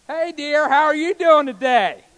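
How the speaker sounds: very loud, high shout to someone right beside you; needlessly shouting. Shown as a demonstration. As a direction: bellow an affectionate greeting as if they were far away. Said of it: "I don't have to talk like that".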